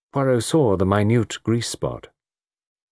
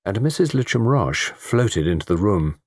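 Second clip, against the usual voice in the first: ordinary delivery, nothing marked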